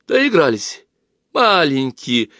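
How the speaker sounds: high-pitched indignant exclamation; exclaiming. A narrator's reading. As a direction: cry out from the back as a passenger. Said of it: "воскликнула пассажирка".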